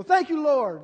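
high, loud burst of thanks; joyful praise. Real, not acted. Preacher praising God before the congregation, loud and joyful. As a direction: shout thanks to the Lord.

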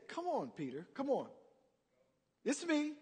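soft, hushed encouragement; gentle coaxing. Acted. Jesus calling Peter out of the boat, softly coaxing. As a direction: coax him forward quietly.

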